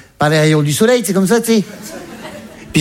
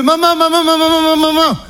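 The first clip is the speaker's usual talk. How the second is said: high, urgent calling of mum; mock panicked calling, high; 10 semitones higher than usual. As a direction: call frantically through the door. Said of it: "Je fais".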